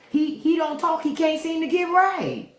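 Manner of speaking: character voice. Retold or imitated. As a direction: mimic the timid movie character. Voicing the movie character.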